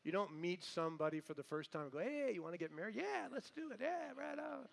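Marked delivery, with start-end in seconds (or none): 2.0-4.7 high, silly voice of a rash couple; mock eager couple. Preacher mocks a hasty couple agreeing to marry, high silly voice. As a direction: lampoon a couple rushing into marriage.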